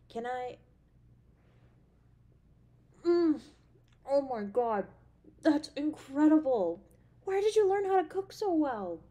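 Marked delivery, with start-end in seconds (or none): 3.1-9.0 loud, high raptures after the first bite; amazed delight. Acted. Girlfriend tasting her partner's cooking, loud amazed raptures. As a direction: rave about the taste.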